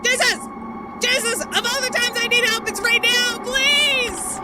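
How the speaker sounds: loud, high panicked pleading; panicked shouting. Real, not acted. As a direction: yell for help in a panic. Begging for help, panicking.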